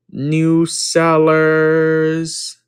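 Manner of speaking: stretched out very slowly; slow reading. Real, not acted. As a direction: spell out the name word by word. Reading raid name slowly.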